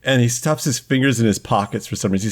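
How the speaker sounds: usual speaking voice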